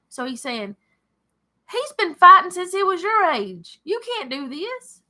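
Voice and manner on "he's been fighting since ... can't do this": doubtful king. Retold or imitated. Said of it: "Saul had doubts".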